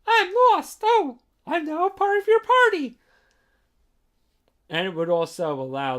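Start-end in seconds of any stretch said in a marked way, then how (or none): 0.1-3.0 much higher, loud, cheerful squeal; ditzy excited cry. Retold or imitated. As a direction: burst in happily lost and joining the party. Izumi popping up anywhere.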